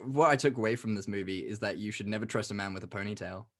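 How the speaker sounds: ordinary delivery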